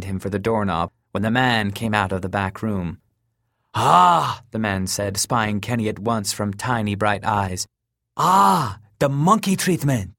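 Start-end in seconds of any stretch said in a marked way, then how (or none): 3.7-4.4 loud, high delighted ah; gleeful exclamation. Acted. Fat man greets eager customer. 8.2-10.1 loud, high, greedy delight; gleeful exclamation. Acted. Fat man greets eager customer.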